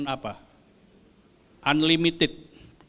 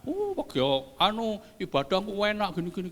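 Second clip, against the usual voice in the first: mock coaxing; 2 semitones higher, 5 dB quieter than usual. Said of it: "bujuki saudara".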